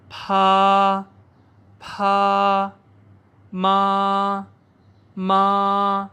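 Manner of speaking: teacher leading a repeat-after-me drill; syllable drill. Shown as a demonstration. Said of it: "جیسے میں پڑھتا ہوں، آپ بھی میرے ساتھ پڑھیے".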